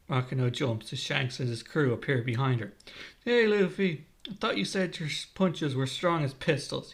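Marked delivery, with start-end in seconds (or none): none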